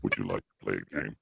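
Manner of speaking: deep male voice filter; low creepy voice-changer man. Shown as a demonstration. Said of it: "Male voice".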